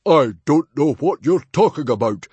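a Viking chief roaring in confusion. A narrator's reading. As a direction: roar it out, genuinely bewildered. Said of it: "roared Stoic the Vast".

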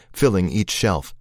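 ordinary delivery

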